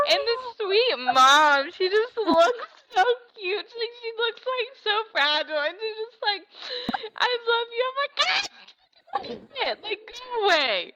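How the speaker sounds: teary and choked up through every phrase, for real; real crying. Real, not acted. Telling her co-host about the mom at the Super Bowl, crying for real. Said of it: "you're crying just talking about it".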